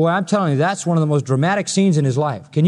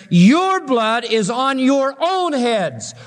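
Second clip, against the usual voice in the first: "Your blood is on your own heads" drawn out slow and high; solemn proclamation; 6 semitones higher, much slower than usual. Retold or imitated. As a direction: stretch every word like a verdict. Same quote, performed slowly.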